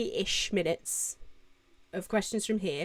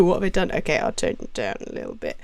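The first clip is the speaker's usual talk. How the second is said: ordinary talk, nothing marked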